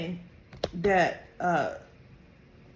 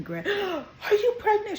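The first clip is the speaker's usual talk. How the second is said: gasp, then a much higher, half-laughing question; startled delight breaking through; a granddaughter gasping at surprise baby news; shocked gasp; 12 semitones higher, slower than usual. Retold or imitated.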